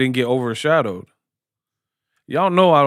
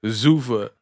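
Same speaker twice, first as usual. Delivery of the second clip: fake Italian accent; 4 semitones lower than usual. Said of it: "fake Italian sounding".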